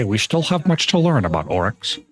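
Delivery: a hint of a grave lore-keeper; quoting NPC line. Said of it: "I would say".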